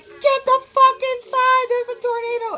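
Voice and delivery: high, loud frantic shout; panicked yell. Retold or imitated. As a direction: scream them inside before the storm. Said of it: "she came, and she was like".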